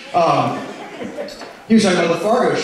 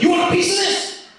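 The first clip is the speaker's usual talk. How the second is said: cake lady. Retold or imitated. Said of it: "She's like".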